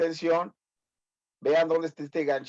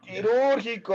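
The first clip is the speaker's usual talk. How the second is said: "quirúrgico" with the U punched high; higher pitch on "quirúrgico", stressing its second syllable; 7 semitones higher than usual. Shown as a demonstration. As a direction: lean hard on the "úr" in "quirúrgico". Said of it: "acento en la U".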